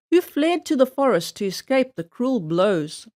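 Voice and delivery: ordinary delivery, nothing marked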